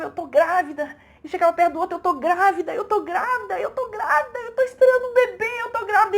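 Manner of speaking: high, loud, overjoyed cries of the news; ecstatic announcing. Retold or imitated. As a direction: shout it out to everyone, bursting with joy. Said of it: "em êxtase de choro e alegria". (gritando de alegria, em êxtase)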